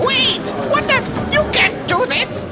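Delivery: high, alarmed protest; panicked protest. Acted. Protesting being taken away.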